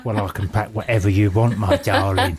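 low, lightly played chat-up voice; smooth suitor. Suitor promising his date a present, low and wooing. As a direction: woo your date, pitched low.

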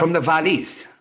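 high, lilting Welsh accent. Shown as a demonstration. Co-host shows partner how to say it, Welsh accent. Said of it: "trying to do Welsh in my".

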